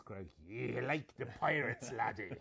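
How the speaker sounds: slow hint of a pirate; drawn-out pirate talk. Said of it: "I like him a little more with the pirate voice".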